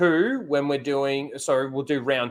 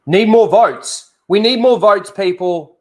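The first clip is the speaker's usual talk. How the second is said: calling out; 5 semitones higher, 9 dB louder than usual. Real, not acted. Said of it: "streamer calling for votes".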